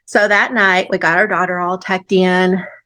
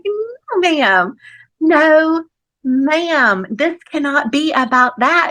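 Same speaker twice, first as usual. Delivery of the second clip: mock protest; 7 semitones higher, slower than usual. Protesting friend's advice in retold dialogue.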